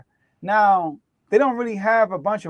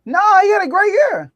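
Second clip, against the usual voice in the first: insistent protest; 12 semitones higher, 7 dB louder, faster than usual. Real, not acted. Defending Fournette in debate.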